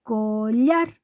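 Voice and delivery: Collar drawn out, weight on llar; stress on last syllable. Shown as a demonstration. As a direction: stretch Collar and lean on the final llar. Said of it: "esa es la tónica".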